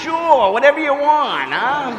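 very high, loud, strained agreement; eager squeak. Retold or imitated. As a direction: squeak out a nervous yes. Priest's forced eager agreement.

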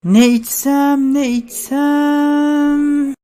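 soft, slow, fading indecisive murmur; mumbling to herself. Acted. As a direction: ponder quietly, trailing away. Indecisive customer pondering the menu.

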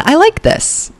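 high, delighted I like this; light up at the look. Real, not acted. Delighted at outfit.

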